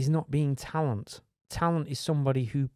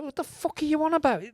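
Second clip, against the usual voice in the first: incredulous; 12 semitones higher than usual. Retold or imitated. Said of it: "It's like".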